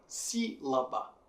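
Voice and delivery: "sílaba" broken up, with a strong "si"; stress on si. Shown as a demonstration. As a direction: punch the first syllable of "sílaba". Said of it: "Si sendo a forte". ("sílaba" separada, com "si" forte)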